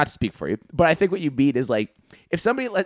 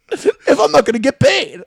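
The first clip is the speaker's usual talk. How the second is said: high loud tradesman rant; 9 semitones higher, 7 dB louder than usual. Acted. Tradesman ranting about a client.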